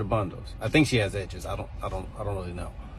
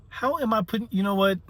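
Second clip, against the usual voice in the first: incredulous; 10 semitones higher than usual. Real, not acted. Teacher exasperated at student.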